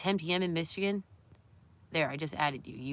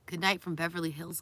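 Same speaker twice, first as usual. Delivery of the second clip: ordinary delivery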